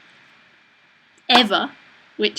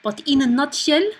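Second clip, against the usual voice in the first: lilting French accent, rising at the end; higher-pitched attempt at French; 5 semitones higher, 3 dB louder than usual. Shown as a demonstration. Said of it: "A French accent".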